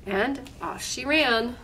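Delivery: plain reading voice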